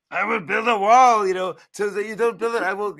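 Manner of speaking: high, loud pleading Grover. Retold or imitated. Grover begging the reader not to turn, high pleading. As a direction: beg the reader like a panicked puppet. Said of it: "he's like".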